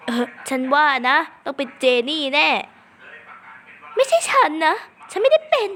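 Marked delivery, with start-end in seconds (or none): 4.0-5.7 high mock denial. Accused in game, denying.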